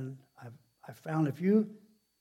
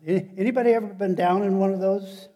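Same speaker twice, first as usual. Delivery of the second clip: loud, raised-pitch appeal to the crowd; calling out; 6 semitones higher, 8 dB louder, faster than usual. Real, not acted. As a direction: throw the question out to the whole congregation. Preacher calls out to congregation.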